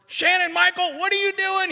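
high-pitched, alarmed teacher's yell. Retold or imitated. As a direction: shriek it like a teacher catching a kid. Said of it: "she starts yelling at me".

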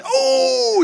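high, drawn-out "oh" at a big hit; re-enacted football fan's whoop; excited yell. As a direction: holler like a fan leaping from the chair. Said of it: "all my wife hears is".

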